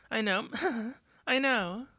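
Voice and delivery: half laughing as she repeats herself; teary friend laughing it off; laughing through tears. Acted.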